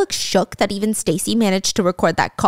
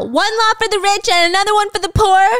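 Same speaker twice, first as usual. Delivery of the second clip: high, loud mock yell from a cell; mock scream; 10 semitones higher, 5 dB louder than usual. Michael yells from his cell at the departing rich man, mock scream. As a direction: shout it through the bars, imitating him. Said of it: "Michael is screaming out of his cell".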